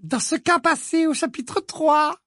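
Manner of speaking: high, slow, falsely sweet teacher; a sickly-sweet strict witch; Umbridge voice. A narrator's reading. Ombrage voiced by narrator. (voix haute et mielleuse)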